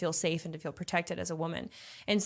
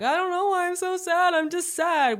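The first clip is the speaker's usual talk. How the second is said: high mock-whiny voice, much higher and louder; helpless whiny sadness; 11 semitones higher, 5 dB louder than usual. Overwhelmed partner whining about unexplained sadness, in a high mock-whiny voice. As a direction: play a lost, confused sulk in a squeaky put-on voice. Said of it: "the amygdala freaking out, being like".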